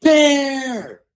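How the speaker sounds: high-pitched mock yell of alarm; retold yell. Camper warns the hunters of a bear, retold yell. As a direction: call it out high and drawn out. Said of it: "she yells".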